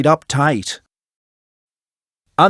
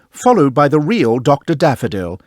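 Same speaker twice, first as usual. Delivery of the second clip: plain reading voice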